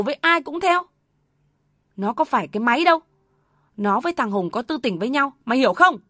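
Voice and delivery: high, loud, furious scolding; screaming outrage. Acted. Mother screaming at her son over his story, furious. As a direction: scream it at him in disbelief. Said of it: "bỗng gào lên".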